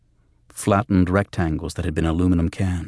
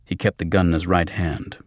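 usual speaking voice

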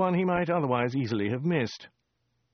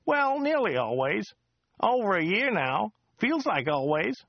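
high, chatty local driver voice; a rambling cab driver, pitched up; chatty driver Joe; 8 semitones higher than usual. Acted. Driver Joe chatting to Dirk on the drive, high chatty voice. Joe's voice again.

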